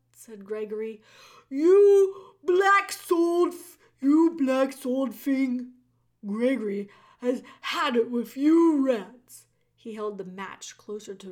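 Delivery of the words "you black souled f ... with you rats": loud, slow, sputtering rage; furious jailer. A narrator's reading. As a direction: spit it out in fury. Voices Gregory the jailer.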